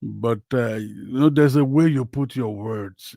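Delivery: normal talking voice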